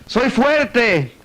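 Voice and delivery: high, bold call; acted shout. Man regaining courage in cave, acted shout. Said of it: "Y empezó a gritar".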